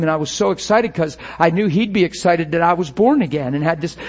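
ordinary talk, nothing marked

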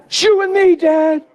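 put-on high, slow, pleading boy's voice; a distraught fourteen-year-old pleading with his dad, high; teenage son's voice. Son's line repeated.

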